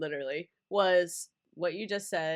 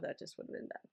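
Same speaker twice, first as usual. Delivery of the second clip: whisper; 18 dB quieter, faster than usual. Real, not acted. Trails off on a grim thought.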